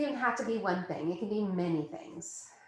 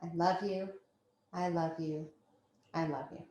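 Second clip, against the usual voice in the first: soft affirmation; 2 semitones lower, 5 dB quieter than usual. Acted. Affirmation chant.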